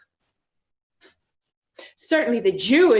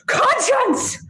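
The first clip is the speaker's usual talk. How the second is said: higher, sharp exclamation; higher exasperated call; exasperated cry; 11 semitones higher than usual. Acted. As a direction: cry out in frustration. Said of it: "Say I".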